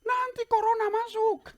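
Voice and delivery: very high, loud imitated kid; alarmed mock child's shout; child yelling. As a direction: cry a warning like a little child. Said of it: "anak saya teriak".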